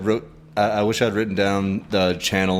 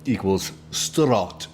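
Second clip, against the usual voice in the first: slow Russian accent drill line; much slower than usual. Shown as a demonstration. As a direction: read it slowly in a Russian accent. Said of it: "sounds like a".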